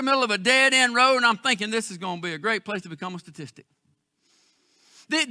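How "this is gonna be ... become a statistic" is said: muttered thought. Retold or imitated. Inner thought, dead-end road worry.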